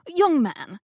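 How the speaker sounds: a hint of a gracious elderly hostess; genteel old woman welcoming the police; lightly voiced grand old lady. A narrator's reading. Rose addresses officer.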